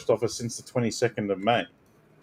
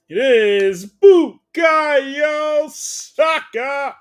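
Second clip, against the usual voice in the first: very slow, much higher drawn-out reveal; hammed-up falsetto reveal of the star player; mock announcer; 8 dB louder, much slower than usual. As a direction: drag every word out like a ring announcer.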